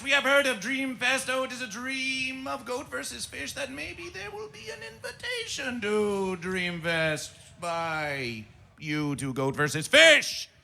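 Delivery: ordinary talk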